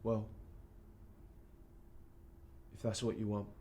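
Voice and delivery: low, soft, halting reply; crushed father with long pauses; hurt resignation. Acted. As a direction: give in quietly, wounded. Hurt dad gives in to son.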